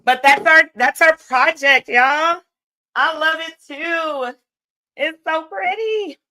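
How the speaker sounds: high, loud, delighted praise; gushing excitement. Real, not acted. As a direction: gush over the finished project. Excited wrap-up praising the project.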